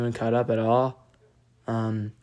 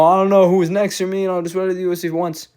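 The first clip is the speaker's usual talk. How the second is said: higher, louder, fast mocking imitation of a shrugging fighter; mocking Van; 6 semitones higher, 3 dB louder, faster than usual. Fan mocks the champ's careless post-fight answer, higher, louder and fast. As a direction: toss it off, higher and faster, sneering at him.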